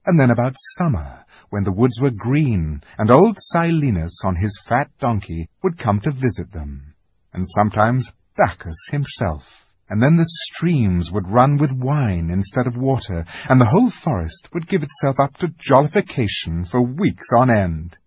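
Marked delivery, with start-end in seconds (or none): none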